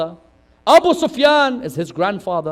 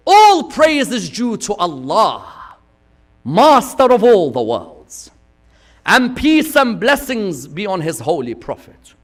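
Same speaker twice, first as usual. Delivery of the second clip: preaching climax; 3 semitones higher, 7 dB louder than usual. Acted. Preacher building to Zainab's sermon, loud. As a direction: lift it up to the peak.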